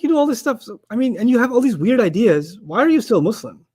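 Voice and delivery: high-pitched retold challenge; incredulous questioning. Retells challenging the odd professor.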